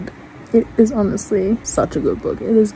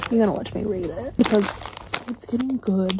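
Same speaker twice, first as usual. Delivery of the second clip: much softer, absorbed in reading; quiet, awed aside; hushed; 2 semitones lower, 10 dB quieter than usual. Real, not acted. Absorbed in a tense chapter.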